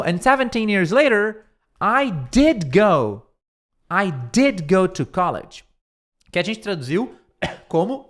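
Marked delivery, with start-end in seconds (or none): 0.0-5.6 did pushed louder, words slowed; stress on did. Shown as a demonstration. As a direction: lean hard on did. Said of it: "Mas ele falou".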